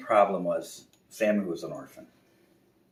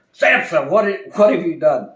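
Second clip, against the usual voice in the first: loud, higher owl; owl demanding; 10 semitones higher, 14 dB louder than usual. Acted. Owl to the bear, loud demanding. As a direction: demand it sternly. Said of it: "and the owl said".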